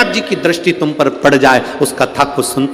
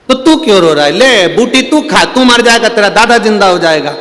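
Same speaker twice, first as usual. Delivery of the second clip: pushy guru; 6 semitones higher, 6 dB louder than usual. Acted. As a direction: push the herb on the boy. Guru tests the grandson.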